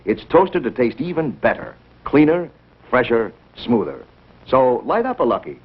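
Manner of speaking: usual speaking voice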